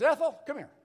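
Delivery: high, loud call for Ethel; hammed-up drunk hollering to his wife; drunk man calling. Drunk man at the door calling his wife, hollering.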